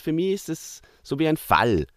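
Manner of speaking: ordinary talk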